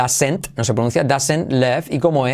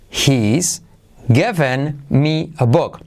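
slow, careful model sentence; slow teaching demo; much slower than usual. Shown as a demonstration. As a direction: spell it out slowly for students. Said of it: "Mira lo que tenemos". (frase modelo lenta)

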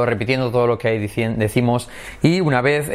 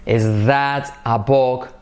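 very slow, spaced words; slow it right down for repeating; slow question demo; slow repeat model; slower than usual. Shown as a demonstration. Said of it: "Repite conmigo".

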